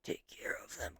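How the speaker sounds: breathless, battered whisper; the bloodied captain whispers his plea to Jinwoo, barely able to speak; weak whisper. Retold or imitated. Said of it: "And the captain's like".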